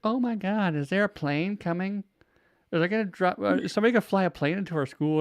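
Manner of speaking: put-on panicky kid voice. Panicked classmates quoted.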